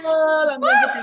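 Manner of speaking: loud, fast, high shouting; excited shout. Real, not acted. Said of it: "I was excited".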